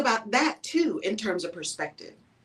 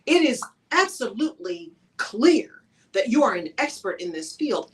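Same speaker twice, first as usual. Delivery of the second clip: usual speaking voice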